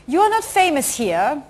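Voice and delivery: put-on halting, slow German-accented question; mock German accent, word by word; German journalist. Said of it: "German, uh, journalists come in and say".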